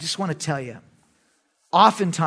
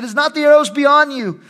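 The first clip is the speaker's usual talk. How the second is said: louder and higher, calling across the field; 8 semitones higher, 6 dB louder than usual. Acted. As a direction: call to the boy far away. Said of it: "Jonathan cried out to the lad".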